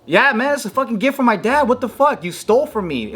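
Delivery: high-pitched outraged retort; indignant protest. Retold or imitated. Outraged kid confronting older bullies.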